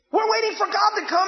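ordinary delivery

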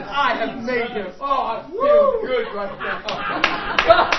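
loud, slow, jubilant declaration; rejoicing preacher's shout; exultant proclamation. Real, not acted. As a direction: proclaim it at full volume, savoring it. Preacher exulting over God's word.

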